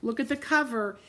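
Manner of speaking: plain reading voice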